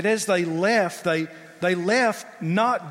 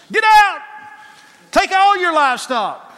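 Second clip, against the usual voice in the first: loud, high barking command; barked order; 12 semitones higher, 11 dB louder than usual. Acted. Pharaoh barking at Moses, loud angry command. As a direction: bark it like a furious king. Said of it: "He's the one barking the orders".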